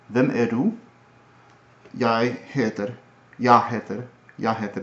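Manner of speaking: Hvem er du in a light Swedish accent; Jeg heter the Swedish way, no G. Shown as a demonstration. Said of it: "And then Swedish, I think, is more like".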